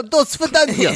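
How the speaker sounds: high, hammed-up fake Russian goodbye; bad Russian accent. Friend mocks the film's Russian with a bad Russian accent. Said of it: "Terrible Russian accents".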